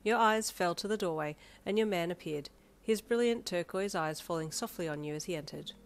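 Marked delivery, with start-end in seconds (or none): none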